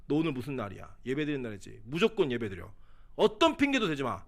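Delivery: stern scolding father. Retold or imitated. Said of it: "제일 무서운 표정을 하면서".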